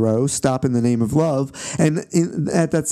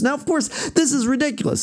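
incredulous scoff; 12 semitones higher than usual. Real, not acted. Scoffing at Gordy's claim.

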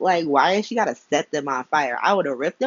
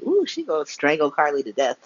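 ordinary talk

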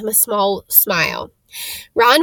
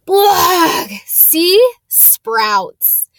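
disgusted spit; 8 semitones higher, 5 dB louder, much slower than usual. Acted. Ron bites a sprouts bean.

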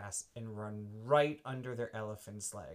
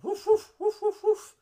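high loud playful barks; dog barking; 14 dB louder than usual. Acted. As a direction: yap like a little dog.

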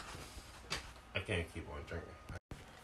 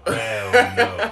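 laughing shout; 12 semitones higher, 11 dB louder than usual. Real, not acted. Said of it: "repeated louder, laughing".

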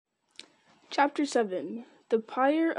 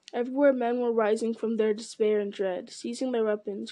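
ordinary delivery

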